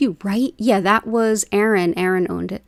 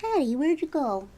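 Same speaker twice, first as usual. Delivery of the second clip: pet talk; 12 dB quieter than usual. Real, not acted. Coaxing her cats Hattie and Bubba over, very high soft baby talk. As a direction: coax the kitty over in a high sing-song. Searching for the cat.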